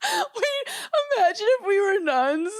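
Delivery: high, laughing through the words; breathless laughing delight. Real, not acted. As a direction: giggle out the idea. Laughing at the nun idea.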